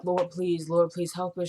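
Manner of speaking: low, hushed mock prayer; murmured, barely hysterical pleading; subdued distraught plea. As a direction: imitate a quietly desperate plea to God. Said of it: "she's kinda hysterical, but not really".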